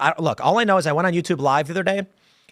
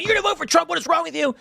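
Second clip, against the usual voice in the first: much higher, imitating an outraged poker player; mock outraged; 12 semitones higher, faster than usual. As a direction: play the guy losing it over a Trump vote. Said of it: "this guy lost it".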